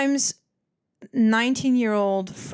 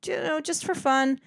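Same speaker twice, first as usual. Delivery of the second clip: very high, sweet customer reply; high, sugary just-for-fun; 9 semitones higher than usual. Retold or imitated. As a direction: answer in a high, polite sing-song. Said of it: "I'm like".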